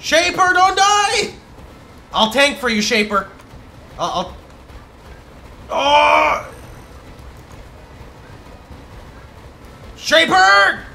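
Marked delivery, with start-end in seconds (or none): 0.0-1.3 high, loud pleading cry; desperate shout. Real, not acted. Pleading to dying ally. 5.7-10.8 high, loud crying shout; anguished cry. Real, not acted. Ally dies, anguished cry.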